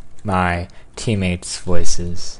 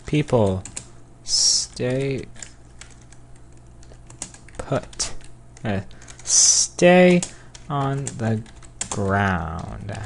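word-by-word with long pauses; slow dictation; much slower than usual. Real, not acted. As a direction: say each word as you type it. Slowly sounding out typed message.

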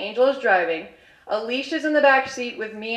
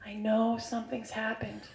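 soft, tactful retold confrontation; delicate; 12 dB quieter, slower than usual. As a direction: broach it gently and quietly. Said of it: "very efficiently and also delicately".

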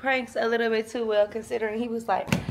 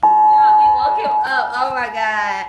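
excited shouting; 13 dB louder, slower than usual. Real, not acted. Loud excitement after prank.

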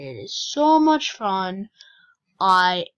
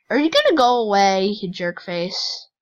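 louder, fast frustrated shout; fast annoyed yell; 8 dB louder than usual. Real, not acted. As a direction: snap it at the pest chasing you. Yelling at chasing player.

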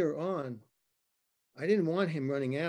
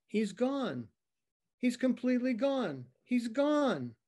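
panicked caller; 6 semitones higher than usual. Acted. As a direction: blurt it out like a scared witness on the phone. Panicked caller reporting a crash.

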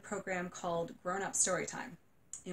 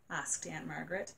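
plain reading voice

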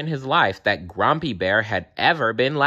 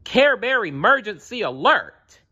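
high-pitched alarm call; urgent cry; 13 semitones higher than usual. A narrator's reading. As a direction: cry out the alert. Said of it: "he cried".

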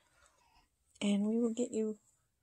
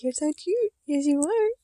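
higher, cooing, rising ends; baby talk; 6 semitones higher, 4 dB louder than usual. Shown as a demonstration. Showing viewers how she talks to the dolls. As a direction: coo it like to a baby. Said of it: "I talk the same way to 'em".

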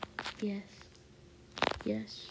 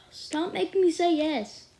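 higher, louder complaint; exasperated; 6 semitones higher, 4 dB louder than usual. Real, not acted. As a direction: protest at the game. Arguing with the game.

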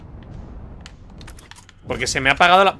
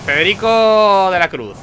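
presidente de mesa; 6 semitones higher, 7 dB louder, slower than usual. Acted. Election president reading out a voter's name, announcing. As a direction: read out a name, slow, higher and louder. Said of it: "el presidente dice".

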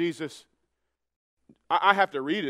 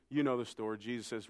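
normal talking voice

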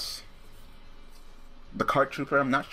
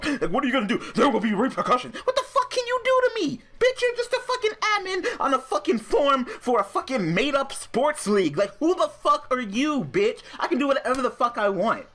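much higher, louder strained shouting; furious rant; 12 semitones higher, 3 dB louder than usual. Real, not acted. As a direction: rail at the admin, voice straining high. Furious rant at forum admin.

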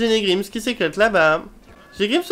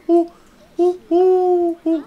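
high hooting bird call; bird hoots; 11 semitones higher, 4 dB louder, much slower than usual. Pretend. Sim playing a bird hoots it out. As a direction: hoot like a bird. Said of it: "Je fais l'oiseau".

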